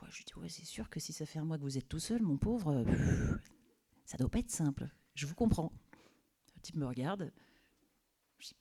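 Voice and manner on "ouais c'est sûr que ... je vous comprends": soft, gentle sympathy for the boss; calm him down softly; soothing. Retold or imitated. Temp soothing her overwhelmed boss, soft and gentle.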